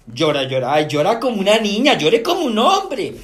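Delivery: loud, high, exasperated parent; mock scolding. Acted scolding of crying boy.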